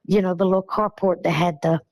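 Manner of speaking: ordinary talk, nothing marked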